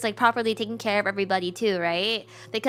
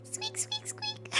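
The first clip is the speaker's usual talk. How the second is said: very high, soft squeaks; mouse squeak; 13 dB quieter, much slower than usual. Acted. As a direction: chirp it like a tiny mouse. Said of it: "Your voice acting is on point".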